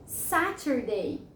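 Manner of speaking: "Saturday" slowly as SA-ter-day with a clear T; model pronunciation. Shown as a demonstration. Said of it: "vamos pronunciar cada uma delas".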